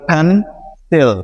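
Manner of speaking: "Fan", stop, then a separate "সিল"; syllable by syllable. Shown as a demonstration. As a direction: split it in two with a pause after "fan". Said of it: "এখানে দুইটা অংশ আছে". ("Fan" আর "সিল" দুই ভাগে থেমে থেমে)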